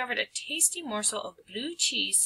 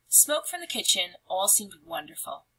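plain reading voice

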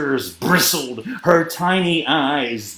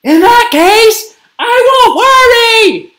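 high, loud, carefree shout; bellowing; 9 dB louder than usual. A narrator's reading. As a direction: bellow it back to mom. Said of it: "bellowed Gabby".

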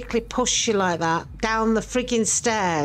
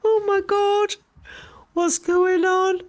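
higher, slow, alarmed; rising panic, drawn out; frightened; 10 semitones higher, 3 dB louder, slower than usual. Real, not acted. Viewer gets alarmed at the moving ghost footage.

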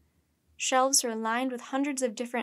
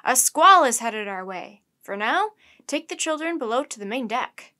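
loud captain's command, easing a little by the end; raised, urgent voice of command; shouted orders; 9 dB louder than usual. A narrator's reading. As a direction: call out orders over the deck. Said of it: "the captain shouted".

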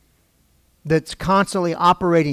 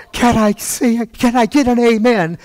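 exulting speaker; 6 semitones higher, 4 dB louder than usual. Retold or imitated. Excited event speaker asks the crowd for an amen, mock exulting. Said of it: "He's so excited".

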